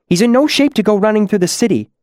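high, fast argumentative voice; urgent objection. Acted. Green-masked demon against taking the hurt kid, urgently objecting. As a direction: argue it quickly, pitched well up. Other demon argues with Incanus.

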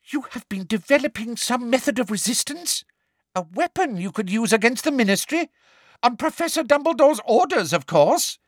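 high, gleeful prim witch; teacher gleefully accusing pupils; Umbridge voice. A narrator's reading. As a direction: savour the accusation.

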